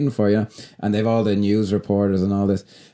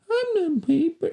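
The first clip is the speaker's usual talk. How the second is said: very high, slow fake child; adult putting on a squeaky little-boy voice; mock kid's voice; 3 dB quieter, slower than usual. Boy answering her in rock paper scissors, mock kid's voice. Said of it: "an adult doing a kid's voice".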